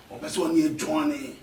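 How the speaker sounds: ordinary talk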